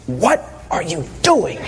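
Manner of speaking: low hushed wife's question; wife mid-ceremony, hushed and puzzled; wife's puzzled whisper. Retold or imitated. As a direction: imitate the wife asking, hushed. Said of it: "she says".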